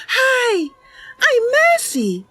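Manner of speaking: put-on very high, bright girlish character voice; perky Mercy voice. Mercy greeting a stranger brightly, very high and cheery. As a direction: play her as a cheerful young cat.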